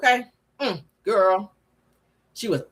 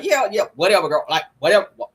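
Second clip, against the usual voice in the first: a lower, offhand brush-off; mild dismissive Sheree; 6 semitones lower than usual. Retold or imitated. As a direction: wave her off like Sheree would. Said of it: "Sheree be coming after her ass and be like".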